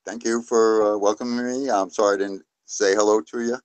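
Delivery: ordinary delivery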